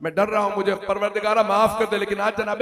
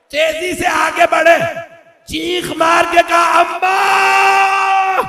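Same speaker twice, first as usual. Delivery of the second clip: climbing higher and louder to a long drawn-out cry; building lament cry; 7 semitones higher, 7 dB louder, much slower than usual. Acted. Preacher crying out Husain's call to his mother, rising wail. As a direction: build from pleading to a wailing shout of Amma.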